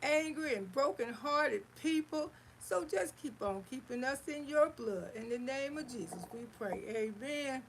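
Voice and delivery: wrapping up a prayer to the Lord; closing prayer. Real, not acted. Auntie closing her prayer for the chat, earnest and prayerful.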